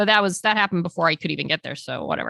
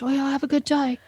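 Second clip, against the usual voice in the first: weak imitation of a Scottish accent, pitched higher; a badly faked Scottish accent, higher; Ewan's Scottish accent; 6 semitones higher than usual. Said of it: "in this cute accent, it sounds nothing like what I just did".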